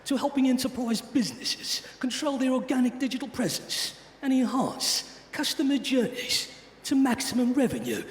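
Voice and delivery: put-on a tough British accent in the style of an action-film star; Jason Statham British accent. Host reading the speaker's bio to the crowd, in a tough British accent. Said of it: "British accent, but as Jason Statham".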